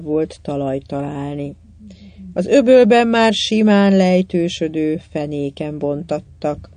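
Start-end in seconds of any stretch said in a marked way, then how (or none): none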